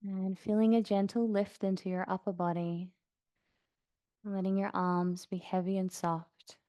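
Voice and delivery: soft, even meditation voice with long pauses; guided meditation. Acted. Yoga teacher guiding class to notice sounds around them, calm meditation voice. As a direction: settle them gently into stillness, unhurried.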